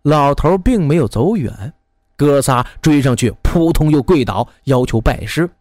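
usual speaking voice

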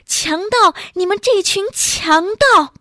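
high-pitched outcry at attackers; acted high, defiant yell; crying out. A narrator's reading. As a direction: cry out in outrage, pitch thrown up high. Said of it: "嘴里还大声地喊道".

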